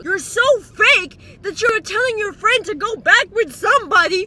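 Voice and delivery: high, loud screaming rant; screaming at a friend who gives bad advice. Real, not acted. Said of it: "And I'm screaming".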